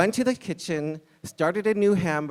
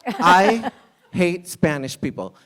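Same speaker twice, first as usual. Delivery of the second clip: louder, slower, through a laugh; laughing restart; 3 semitones higher, 7 dB louder, slower than usual. Shown as a demonstration. Restarting the line for Ogle, louder and laughing. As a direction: say it again, laughing and leaning in. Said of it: "I'm sorry, Ogle".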